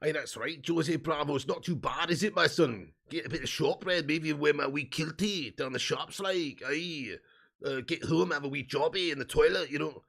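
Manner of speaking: comic put-on Scottish accent. Streamer resuming the requested character, mock Scottish accent. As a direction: chat like a caricature Scotsman. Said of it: "Do a Scotland accent".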